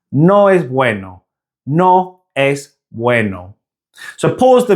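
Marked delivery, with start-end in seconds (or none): 0.1-1.2 "No es bueno" joined up, as naw ess BWEH-no; clean Spanish pronunciation. Shown as a demonstration. Teacher repeating the model sentence, Spanish "No es bueno". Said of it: "much cleaner Spanish sounding sentence". 1.7-3.6 "No es bueno" slow, short pure E and O; clean Spanish pronunciation. Shown as a demonstration. Teacher drilling learners one last time, slow Spanish "No es bueno". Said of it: "much cleaner Spanish sounding sentence".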